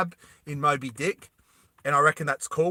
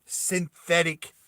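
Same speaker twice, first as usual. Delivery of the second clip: ordinary delivery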